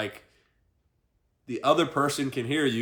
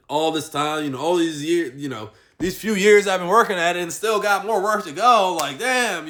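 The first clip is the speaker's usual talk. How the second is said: louder, higher, worked-up complaint; mock exasperation; 5 semitones higher, 5 dB louder than usual. Man griping about years of work with more to go, mock exasperated. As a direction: gripe as if fed up with all that work. Voices frustration at slow progress.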